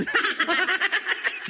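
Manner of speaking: high amused cackle; high laugh. Real, not acted. Vlogger laughing at his duck-betting joke, high laugh. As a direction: laugh at your own joke.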